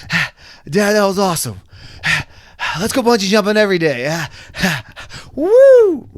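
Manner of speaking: high, loud, fast thrilled dog voice; ecstatic dog. Pretend. Voicing the dog telling Dad it was awesome, loud excited. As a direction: gush like a dog that loved it, whoop at the end.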